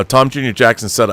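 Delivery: ordinary talk, nothing marked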